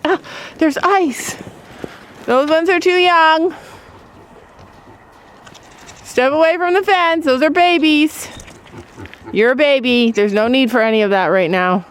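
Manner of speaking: high scolding goat voice; voicing the doe. Doe voiced shooing babies from fence, high scolding. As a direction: tell the young ones off in a high voice. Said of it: "She's like".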